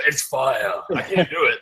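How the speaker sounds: badly faked New York accent, low; low, half-hearted New York accent; attempted New York accent. Shown as a demonstration. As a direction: have a failed go at New York. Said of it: "I can't, I can't even do that".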